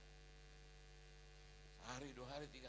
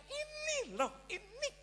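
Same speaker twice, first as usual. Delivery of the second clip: higher, very slow, showing something off; God points it out with a drawn-out lilt; 7 dB louder, much slower than usual. Acted. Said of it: "Tuhan bilang". (suara Tuhan tinggi dan lambat, menunjukkan)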